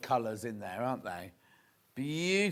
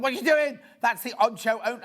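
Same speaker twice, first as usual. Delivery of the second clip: high, loud outraged cry; alarmed protest; 7 semitones higher, 5 dB louder than usual. Retold or imitated. Host to friend grabbing the on-show tea towel, alarmed. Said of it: "I was like".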